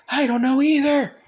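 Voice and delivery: high, loud panicked answer; panicked cry. Acted. Izuku shouting back to Isobu, high and panicked. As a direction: blurt it out high and scared.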